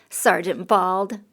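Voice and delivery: slow sneering jab; snickering mockery. A narrator's reading. Companion mocking the sergeant, slow and snickering. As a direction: drag out the name to mock him. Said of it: "heard his companion snicker".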